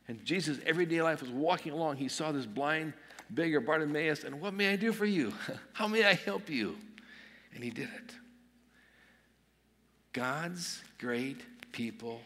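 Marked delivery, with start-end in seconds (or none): 4.4-6.8 high-pitched, lively offer with a small laugh; eager offer. Acted. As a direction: pitch it up, offering help on the spot. Acts out Jesus offering help in passing.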